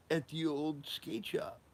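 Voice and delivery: high, slow drawn-out reveal; imitating Sid. Sid reveals where the new shop will be, high and slow. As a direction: let the news sink in slowly.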